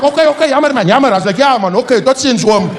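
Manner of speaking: high, apologetic Afrikaans voice; cowed Afrikaans man. Retold or imitated. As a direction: back off meekly, sorry sir.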